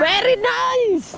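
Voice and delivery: high, long drawn-out cheer; excited praise. Real, not acted. Fisherman echoing a mate's praise, drawn-out cheer. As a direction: stretch it out slowly and high.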